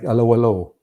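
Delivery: quoting a German officer, a mock German accent. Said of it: "the German officer".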